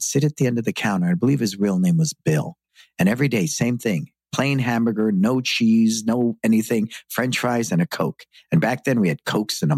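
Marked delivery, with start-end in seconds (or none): none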